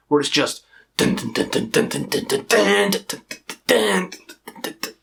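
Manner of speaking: chugging dun dun riff imitation; imitating riffs. Shown as a demonstration. Showing the heavy riff style, chugging. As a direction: chant the riff like a guitar. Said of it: "this kind of death metal".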